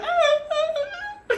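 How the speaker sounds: high, slow, strangled gasps; imitated choking gasps. Choking victim gasping while the wire is round his throat. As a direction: choke out the sounds like he's being strangled. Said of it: "You're like".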